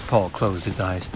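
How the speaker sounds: plain reading voice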